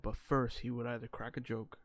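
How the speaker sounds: usual speaking voice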